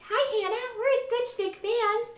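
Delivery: very high, sugary fake greeting; put-on high girly gushing; fake-nice fans. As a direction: squeal it through a forced smile. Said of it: "they're smiling on the outside".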